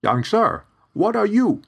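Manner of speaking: high, loud shocked question; startled cry. Acted. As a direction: jump in fright mid-sentence. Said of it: "he startled when he saw me".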